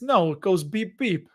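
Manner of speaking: put-on light New York accent on a bus driver's punchline; a hint of New York cabbie in the joke. Bus driver joke in a light New York accent. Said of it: "That was a little New York accent".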